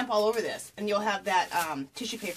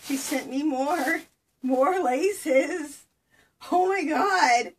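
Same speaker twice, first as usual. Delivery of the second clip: rising excitement; 7 semitones higher, 6 dB louder, much slower than usual. Real, not acted. Overwhelmed by more lace.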